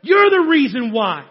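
loud, pointed accusation; angry blame. Acted. Elijah arguing with God, loud and accusing. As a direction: jab the blame straight at God. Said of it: "He's arguing with God".

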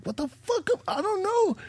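startled singer caught off guard, high and panicked; panicked surprise. Retold or imitated. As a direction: squeal it out in alarm. Panics when told to sing.

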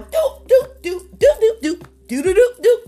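playful dial-tone beeps with the mouth; short punched "do" beeps; imitated phone dialing. Preacher pretends to dial up blessings, imitated dialing beeps. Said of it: "Let me go ahead and begin to dial".

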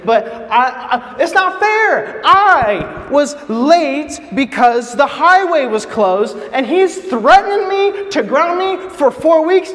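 put-on high, drawn-out indignant teen complaint; upset protest. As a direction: whine loudly about the unfairness, stretching words. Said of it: "I'd never been so upset".

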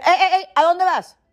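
playful mock call "hey, where are you going". Mother stopping kids leaving table.

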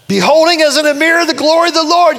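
high, loud, sustained scripture cry; full-force preaching. Real, not acted. Preacher returns to the verse on glory, full-force preaching. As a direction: proclaim the verse in one ringing sweep.

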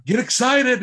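imitating a hyped guy pumping everyone up; mock excited shout; super hyped. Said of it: "that's when he's super hyped".

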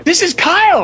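high, loud excited introduction; excited intro. Retold or imitated. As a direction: announce him like a big surprise. Friends excitedly introducing Kyle.

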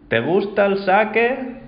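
put-on a little old man calls out to the drunk; high slow elderly voice asking about sake; old man calling.

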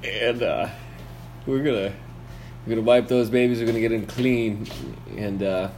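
a lightly hammed-up radio announcer read; mock radio ad. Host announcing a fake sponsor to the listeners, a lightly hammed-up pitch. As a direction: sell it like a cheesy radio host. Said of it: "Now time for our commercial break".

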